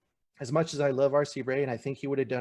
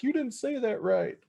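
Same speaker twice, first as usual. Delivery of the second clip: mock critic; 11 semitones higher than usual. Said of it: "No one's gonna be like".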